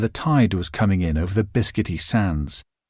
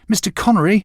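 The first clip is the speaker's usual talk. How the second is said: higher, a louder second call to get his attention; the boy calling louder as the man walks off; 9 semitones higher, 4 dB louder, faster than usual. A narrator's reading. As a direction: call his name again, more urgent. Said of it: "I called to him".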